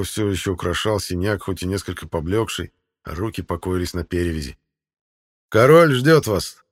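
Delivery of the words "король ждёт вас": loud, slow royal announcement; proclaiming loudly. A narrator's reading. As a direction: herald it to the hall. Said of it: "возгласил синешаль". (громко и торжественно, как глашатай)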